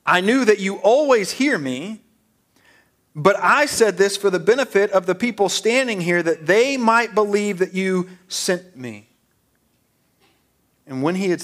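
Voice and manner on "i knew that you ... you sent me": raised, loud declamatory prayer; projected prayer. Acted. Jesus thanks the Father before the crowd, declaiming. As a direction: pray it out for the whole crowd to hear.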